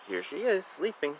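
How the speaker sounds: usual speaking voice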